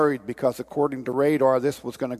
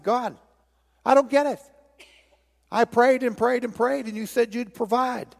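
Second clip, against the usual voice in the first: much higher, bewildered protest to God; indignant complaint; 9 semitones higher than usual. Acted. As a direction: demand an answer from God, aggrieved. Story, drowned man complains to God.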